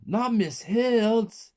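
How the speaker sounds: high, loud, slow pleading cry; desperate plea. A narrator's reading. Child begging the mother, desperate cry. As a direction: beg for the teacher.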